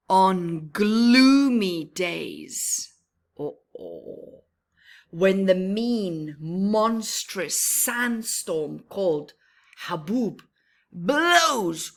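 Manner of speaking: dramatic storm telling, softening to darkness at the end; ominous storytelling. A narrator's reading. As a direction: make the sandstorm menacing. Ominous sandstorm passage.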